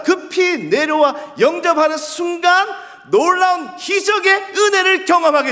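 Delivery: high, loud, impassioned sermon delivery; full-force preaching. Real, not acted. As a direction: proclaim it at the top of your voice to the congregation.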